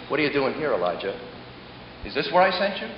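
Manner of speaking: low, very soft questioning; hushed divine question; soft voice of God. Acted. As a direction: ask it near a whisper, gently.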